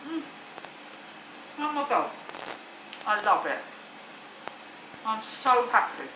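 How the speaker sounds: talking while chewing; words through a mouthful of bread; mouth full. Real, not acted. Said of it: "talking to my mouth full".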